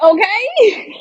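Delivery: high, drawn-out, unimpressed okay; a falsetto sarcastic okay. Retold or imitated. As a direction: let it float up in disbelief. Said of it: "I'm like".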